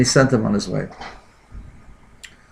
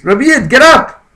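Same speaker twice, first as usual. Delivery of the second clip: loud command; 12 semitones higher, 6 dB louder than usual. Retold or imitated. Commanding the dying man to rise.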